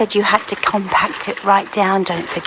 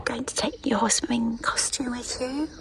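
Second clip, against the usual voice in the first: whispering on the phone; 4 semitones higher, 9 dB quieter than usual. Real, not acted. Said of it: "I have to whisper".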